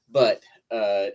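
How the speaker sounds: normal talking voice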